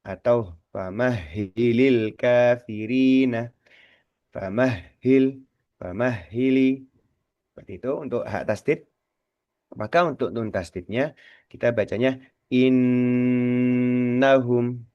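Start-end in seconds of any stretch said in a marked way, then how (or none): 0.1-6.9 famahili said slowly, syllable by syllable. Shown as a demonstration. Teacher comparing famahili with the nun tasdid. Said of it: "Kalau hak tasdid kita bacanya". 9.7-14.9 innahum slowed, nn held longer. Shown as a demonstration. Teacher contrasting innahum with famahili. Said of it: "Lebih lama ya".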